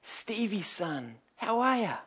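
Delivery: a light imitated New Zealand accent, friendly greeting; Kiwi accent. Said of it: "in his Kiwi accent".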